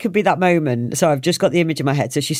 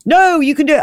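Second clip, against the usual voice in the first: mock encouragement; 8 semitones higher, 4 dB louder than usual. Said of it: "She was like".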